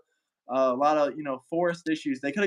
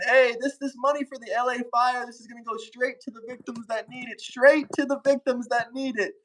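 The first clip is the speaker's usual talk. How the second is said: mock politician voice; 6 semitones higher than usual. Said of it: "they're all saying".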